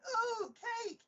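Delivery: put-on very high, delighted child squeal; excited little girl. Little girl spotting the cake, excited high squeal. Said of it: "this tiny little girl".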